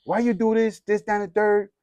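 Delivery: high, even scolding at ordinary volume; mock yell. Dad scolding son in the car, mild mock yell. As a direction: rattle off a parent's telling-off, mildly acted. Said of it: "he yells at me".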